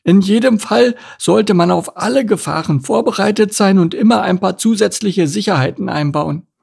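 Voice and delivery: high-voiced, careful turtle character; high turtle voice, agreeing and advising; cautious turtle.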